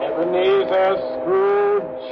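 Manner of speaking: high, ringing call of the name; ringing summons. Acted. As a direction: summon him from afar, voice soaring. Spirit summons Scrooge.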